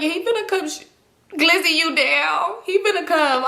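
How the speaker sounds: high-pitched, goading retold threat; taunting threat. Acted taunt during street quarrel.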